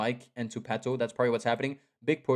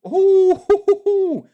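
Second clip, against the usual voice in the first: loud ooh; 13 dB louder than usual. Real, not acted. Caster reacts to a rough hit.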